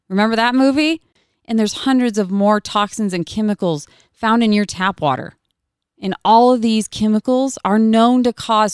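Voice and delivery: upbeat sponsor read, selling it. Acted. Host reading a sponsor ad to listeners, upbeat and selling. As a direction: pitch the product like a radio spot.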